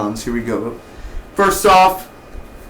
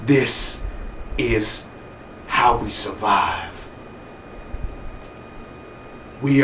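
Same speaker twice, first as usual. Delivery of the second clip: low, slow, hushed rally to survive; grim survivor speech; 3 semitones lower, 6 dB quieter, slower than usual. Retold or imitated. To the crazy nurse, a hushed, fading survival speech. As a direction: talk them into hope, fading softer. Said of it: "go up to her and be like".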